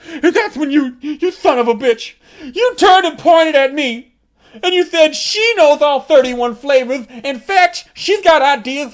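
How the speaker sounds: cracking up while ratting her out; excited accusation. Retold or imitated. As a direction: blurt it out, cracking up. Laughing accusation at friend.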